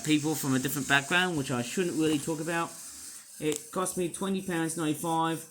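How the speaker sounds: ordinary talk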